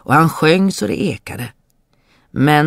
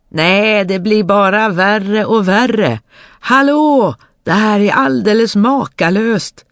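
high, loud delighted exclaiming; bursting with glee over a find; excited; 7 semitones higher, 5 dB louder than usual. Acted. Dummerjöns showing his brothers the mud, excited.

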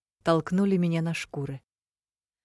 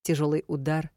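ordinary delivery

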